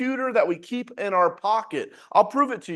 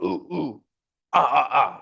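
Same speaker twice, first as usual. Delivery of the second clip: caveman grunts; 4 semitones lower than usual. Pretend. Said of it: "impersonation of it".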